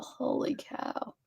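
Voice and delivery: slow, soft unvoiced holy cow; whisper. Real, not acted. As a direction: let it out as a breathless whisper. Stunned whisper.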